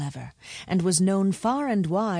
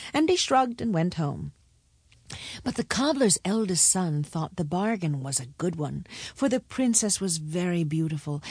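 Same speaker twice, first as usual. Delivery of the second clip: ordinary delivery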